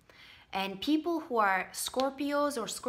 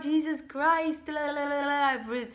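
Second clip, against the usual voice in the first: gaga praying; 4 semitones higher than usual. Acted. Mocking people praying to manifest, gaga prayer voice. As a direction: chant it mockingly, dissolving into babble. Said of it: "being all gaga and praying to God".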